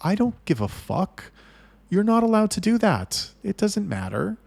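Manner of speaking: high-pitched, exasperated shutdown; angry dismissal. Retold or imitated. Refusing the manager's excuses, angry and exasperated. Retold argument, cutting off excuses.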